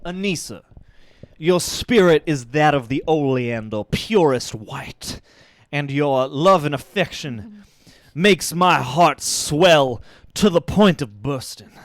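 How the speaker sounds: slow, syrupy southern charm; mock-romantic southern gentleman; romantic southern drawl. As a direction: woo her in a thick southern accent, slowly. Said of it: "Now say something really sweet and romantic to Anissa".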